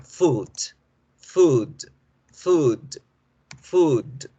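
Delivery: food with a long oo; teacher modelling food with a long vowel. Shown as a demonstration. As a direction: stretch the oo in food. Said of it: "con la U larga".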